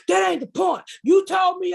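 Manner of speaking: high, loud retold shout; mock yell. Ex yelling at her on the phone, loud and high. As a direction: yell it like he's losing the argument. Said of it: "If you were yelling".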